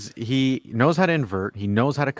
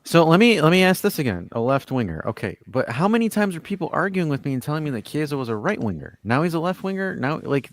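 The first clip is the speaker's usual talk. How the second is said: ordinary talk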